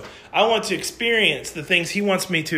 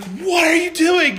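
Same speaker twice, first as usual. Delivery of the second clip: furious shouted question, pitched way up; angry coach yelling; 13 semitones higher than usual. Retold or imitated. Coach yelling at players after dropped pass, furious shout. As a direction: yell it like a livid coach. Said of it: "Our coach was livid".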